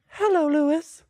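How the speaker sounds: high-pitched put-on imitation of a co-host; Steve. Co-host answering a friend's call as someone else, high impression. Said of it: "I'm trying to do Steve's voice".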